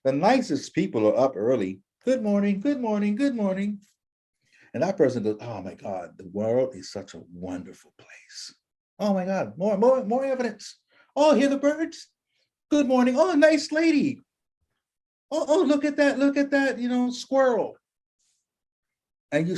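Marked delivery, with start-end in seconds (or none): 2.0-3.8 higher, sing-song good mornings; cheerful greeting. Retold or imitated. Cheerful neighbor greeting people at dawn. 5.4-8.5 awed murmur. Retold or imitated. Cheerful neighbor marvelling at the world. 9.0-17.8 much higher, gushing with joy at everything; giddy delight. Retold or imitated. Cheerful neighbor delighted by nature.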